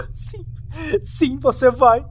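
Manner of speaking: high, loud sobbing accusation; terrified weeping; sobbing plea. Acted. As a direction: cry it out, rocking in fear. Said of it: "continuou a soluçar e balançar".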